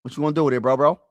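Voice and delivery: ordinary talk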